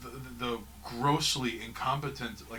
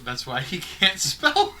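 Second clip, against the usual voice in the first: gleeful punchline; 7 semitones higher, 8 dB louder, slower than usual. Real, not acted. Mocking the pirate wizard.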